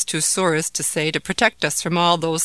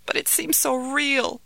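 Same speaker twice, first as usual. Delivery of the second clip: put-on scared child; 8 semitones higher than usual. Scared child after nightmare.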